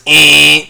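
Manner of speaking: short loud buzzer; alert noise imitation. Podcaster mimics the early morning alert, loud buzzing noise. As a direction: blurt out an alarm buzz. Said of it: "it's a fucking noise".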